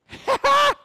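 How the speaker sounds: high, loud burst of laughter; exultant laugh. Real, not acted. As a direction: let out a high, joyous whoop of a laugh. Exultant sound after screaming Jesus.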